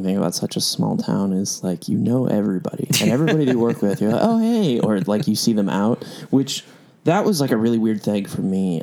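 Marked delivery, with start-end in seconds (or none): 4.2-4.8 high, bright-eyed "oh, hey" on spotting someone; surprised greeting. Retold or imitated. Running into a club regular in town, mock surprised hello. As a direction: act caught off guard by a familiar face.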